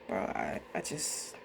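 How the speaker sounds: sighing, voice dropping to almost nothing; breaking off, too upset to finish; choked up. Real, not acted. Choking up to viewers over the lost trade. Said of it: "and I get emotional".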